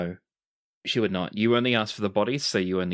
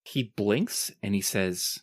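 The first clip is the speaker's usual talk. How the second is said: usual speaking voice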